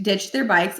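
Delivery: ordinary delivery, nothing marked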